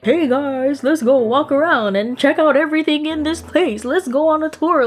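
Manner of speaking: high, cheery mock tour guide; lightly voiced cheerful girl suggesting a tour; chirpy oblivious friend. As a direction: play it bright and clueless, inviting everyone along. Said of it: "she was just like".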